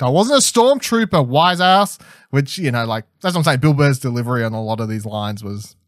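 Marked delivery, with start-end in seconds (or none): 0.0-2.0 quoting a sarcastic comeback, pitched higher; snappy mock wisecrack; a light imitation of a sarcastic retort. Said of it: "He wisecracks back".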